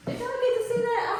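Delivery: sudden high-pitched question to viewers; high playful check-in. Real, not acted. Vlogger squeaking a question at her viewers.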